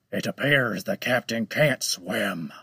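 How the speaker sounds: menacing shark voice. Acted. Shark shrugs off the drowning.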